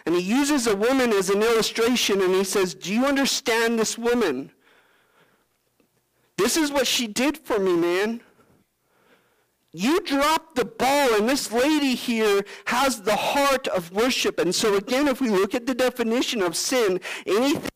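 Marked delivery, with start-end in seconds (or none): 2.8-14.4 higher, slow reproach; Jesus correcting Simon at the dinner table, slow and firm; Jesus gently rebuking. Pretend. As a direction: let him down slowly, firm.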